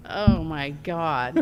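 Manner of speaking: drawn-out Long Island "Oh my God"; slow, big New York-area exclaim; Long Island accent. Real, not acted. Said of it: "That was my Long Island accent for you".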